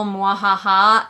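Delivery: slow hammed-up villain cackle; mwahaha, slow and loud; mock evil laugh. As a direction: play a cartoon villain laughing. Evil only villain parody.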